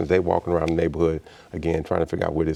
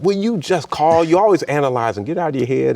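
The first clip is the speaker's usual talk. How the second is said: exasperated wife; 9 semitones higher, 5 dB louder than usual. Retold or imitated. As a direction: impatiently mimic a wife who is fed up. Wife exasperated with his overthinking.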